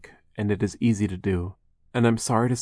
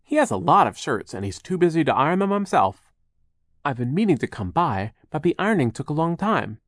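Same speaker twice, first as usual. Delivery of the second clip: lightly voiced Charles, higher and lively; a chatty, higher-pitched storybook helper; mild-mannered Charles; 5 semitones higher than usual. Charles brings the children jerky, lightly acted chatty voice.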